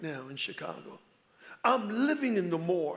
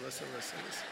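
listen, listen fading to a murmur; trailing soft; 6 semitones lower, 13 dB quieter, much faster than usual. Shown as a demonstration. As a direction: let "listen, listen" drop away much softer.

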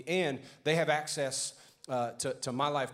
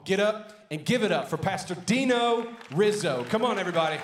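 loud, high rallying call to the crowd; louder, higher crowd-hyping announcer call; hype call-out; 6 semitones higher, 9 dB louder than usual. Real, not acted. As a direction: pump the room up for the guest. Pastor hyping crowd to welcome guest.

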